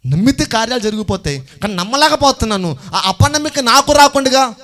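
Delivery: high-pitched, urgent preaching plea; impassioned plea. Acted. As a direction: drive the plea upward with force. Repeats the father's plea with force. (ఆవేశంగా, హెచ్చు స్వరంతో వేడుకోలు)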